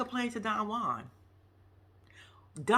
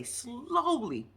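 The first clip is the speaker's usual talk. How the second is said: SLOWLY stretched right out; slowly, said very slowly with a swooping tune; drawn-out emphasis; 5 semitones higher than usual. Real, not acted. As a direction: drag out the word slowly for comic effect. Word stretched to mimic slow storming.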